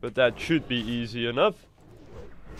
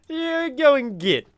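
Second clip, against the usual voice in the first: much higher, slow mock American accent; squeaky hammed-up American accent; bad American accent; 13 semitones higher, 6 dB louder, slower than usual. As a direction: push the fake American twang way up. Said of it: "worst American accent I've ever done".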